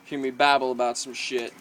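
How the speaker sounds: talking around a dip lip; lip full of dip. Real, not acted. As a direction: speak around a wad of tobacco. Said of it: "throw a lip in".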